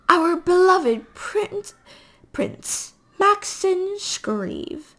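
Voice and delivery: high, slow, ceremonial announcement; lofty drawn-out herald voice; grand proclamation. A narrator's reading. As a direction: announce a royal name, stretching each word. Mother grandly reads out the prince's name.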